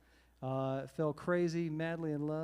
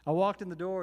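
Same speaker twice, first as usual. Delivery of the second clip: ordinary talk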